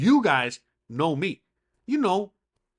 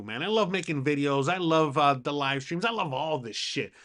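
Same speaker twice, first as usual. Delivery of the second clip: ordinary delivery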